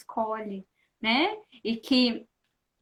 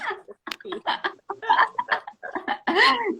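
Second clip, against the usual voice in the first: higher, real burst of laughter; laughing at interruption; 8 semitones higher than usual. Real, not acted. Laughing as the child interrupts the live. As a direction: laugh off the little intruder. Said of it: "Ela quer participar aqui junto".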